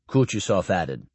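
usual speaking voice

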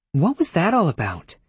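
lightly voiced young woman. A narrator's reading. Granuaile asks.

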